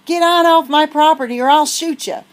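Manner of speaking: put-on high, loud old-man yell; furious old farmer. Old farmer yelling at the girls to get off. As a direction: threaten them off the property.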